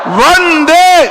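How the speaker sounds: high shouted chant call; booming rally shout. Real, not acted. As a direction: shout it to the crowd. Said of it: "प्रचंड आवाज से बोलो".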